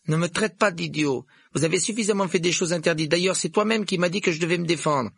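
high, loud schoolboy voice; indignant boy holding his ground; indignant Neville. A narrator's reading. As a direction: stand up to them, pitched high and forceful.

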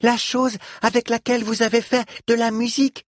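put-on high-pitched child voice; very high, eager little voice. A narrator's reading. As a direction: explain it like an earnest little child. Child explains to flute player.

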